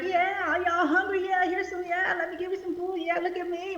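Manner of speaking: high, breezy boastful voice; smug show-off. Retold or imitated. Preacher mocks a show-off handing out food, high and breezy. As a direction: play the one showing off while life is easy. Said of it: "it's easy to be like".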